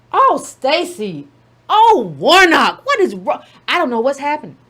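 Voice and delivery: ordinary talk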